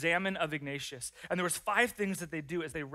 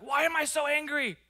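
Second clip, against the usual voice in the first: much higher and louder, exasperated at yourself; mad at yourself; 11 semitones higher, 8 dB louder than usual. Pretend. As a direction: blurt it out, fed up with your own anger. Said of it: "start getting mad at yourself".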